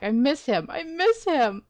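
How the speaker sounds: excited, emotional plea; gushing. Real, not acted. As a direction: gush with longing, voice lifting high. Excited fan reacting to character's return.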